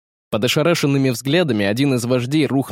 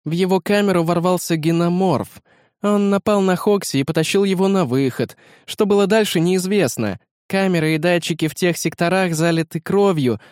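higher, brisk hologram assistant; higher AI girl voice; 6 semitones higher than usual. A narrator's reading. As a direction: report it like a crisp AI. Beta reports to the leaders.

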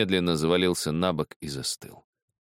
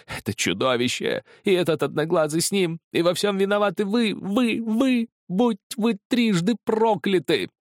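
high, frenzied screaming accusation; breaking into a shout; 3 dB louder than usual. A narrator's reading. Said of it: "Он опять сорвался на крик". (срываясь на истошный крик)

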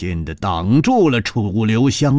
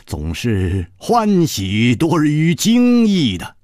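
ordinary delivery, nothing marked

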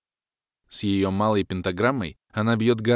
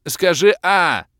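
slow, loud, high teasing call; like telling a child to open wide; coaxing; 10 semitones higher, 9 dB louder, slower than usual. Acted. Hero feeding Kalen a potion, coaxing.

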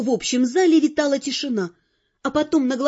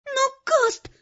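high, plaintive little appeal; pleading girl; 11 semitones higher than usual. A narrator's reading. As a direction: beg weakly in a high voice. (жалобно, высоким девичьим голосом)

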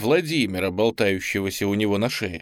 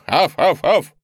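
higher, squeakier barked chant; 6 semitones higher, 6 dB louder than usual. Acted. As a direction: bark it out, thin and squeaky. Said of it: "Уже пописклявее".

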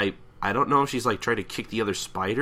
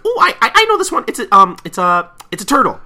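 much higher and louder, eager guessing; Pinkie Pie voice; 11 semitones higher, 10 dB louder, faster than usual. Retold or imitated. Pinkie Pie blurting a wrong guess, high and loud. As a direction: bounce up high like a cartoon know-it-all. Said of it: "She's like".

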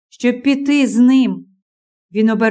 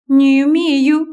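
objecting; 3 semitones higher than usual. A narrator's reading. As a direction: insist she can, pushing back hard. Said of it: "заперечила Консуело".

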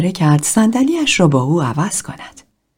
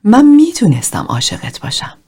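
higher, louder take of «من میتونستم عاشقت باشم»; emphatic second try of the line; stronger repetition; 7 semitones higher, 4 dB louder than usual. A narrator's reading. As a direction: push it out with more feeling this time. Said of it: "تکرار کرد".

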